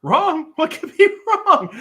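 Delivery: very high, loud squeak with a jittery laugh; nervous laugh. Acted. Joxer, asked if something's wrong, squeaks with a nervous laugh. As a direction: laugh it off in a panicky falsetto. Joxer hides fear from Xena.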